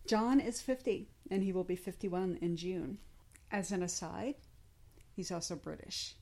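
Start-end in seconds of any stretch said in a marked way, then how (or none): none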